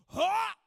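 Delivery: sharp loud pained grunt; a big overdone cry of pain. Shown as a demonstration. Pastor showing how to react when struck, loud grunt. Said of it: "You just gotta kinda go like".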